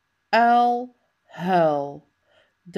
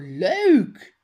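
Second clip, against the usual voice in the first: usual speaking voice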